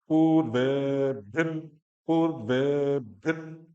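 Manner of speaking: पूर्वेभिर with the ऊ and ए drawn out long, slow; long vowel. Shown as a demonstration. Teacher models पूर्वेभिर with long ऊ and ए. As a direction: stretch पूर्वे in पूर्वेभिर, then भिर short. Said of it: "लेकिन वहां पे time देना".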